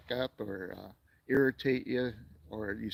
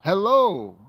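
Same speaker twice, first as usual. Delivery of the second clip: loud cheery hello; 7 semitones higher, 10 dB louder than usual. Real, not acted. Greeting a viewer on live stream.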